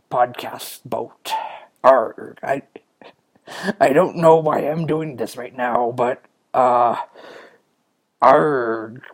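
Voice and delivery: hammy pirate with throaty args. Pretend. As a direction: keep up the silly pirate act. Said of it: "in a pirate's voice".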